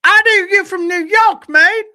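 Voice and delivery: very high, loud imitation shout; squeaky, blaring cartoonish impression. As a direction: do a tiny street hustler yelling. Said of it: "I'm about to do his voice".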